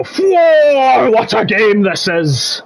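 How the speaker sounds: a hammed-up old-school commentator's cry; mock commentator exclamation. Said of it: "and go".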